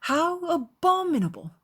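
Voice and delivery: high, loud exclamation of disgust; indignant cry. A narrator's reading. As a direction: cry out appalled. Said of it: "cried Elizabeth".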